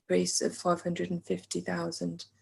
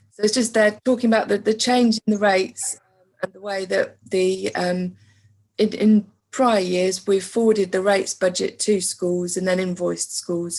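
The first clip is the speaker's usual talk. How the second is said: raised, louder presenting voice; speaking up; 6 dB louder than usual. Real, not acted. Presenter resuming for listeners who couldn't hear, speaking up. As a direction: project to be heard after going faint. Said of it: "speak up a bit".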